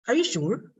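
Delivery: "Are you sure" falling at the end; yes-no question with a downward tune on "sure"; question going down. Shown as a demonstration. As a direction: let "sure" drop instead of rise. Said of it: "they go down".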